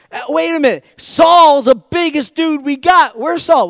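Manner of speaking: high loud shout, stretched out; excited shout. Acted. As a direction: shout the sudden idea to the ranks. Soldiers realizing Saul is biggest.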